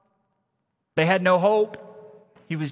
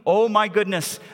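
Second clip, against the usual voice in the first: louder, excited awe; mother amazed; 5 semitones higher, 5 dB louder than usual. Acted. Grieving mother seeing her son alive, louder amazed outburst. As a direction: burst out in astonished faith. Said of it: "she sees".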